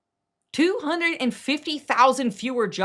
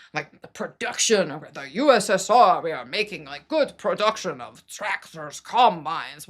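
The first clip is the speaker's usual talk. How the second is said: put-on awful Russian accent; 4 semitones lower than usual. Communist government assuring its people all is great, awful Russian accent. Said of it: "That's my awful Russian accent".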